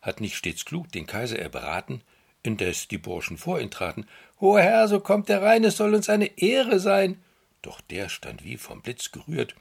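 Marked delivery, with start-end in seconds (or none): 4.4-7.2 high, loud fawning weaver voice. Acted. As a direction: welcome him with sly flattery. Sly weavers greeting the minister.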